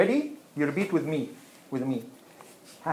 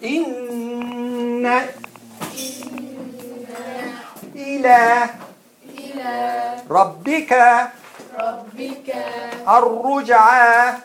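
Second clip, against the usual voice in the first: Quran recitation; 6 semitones higher than usual. Shown as a demonstration. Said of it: "Now the last one".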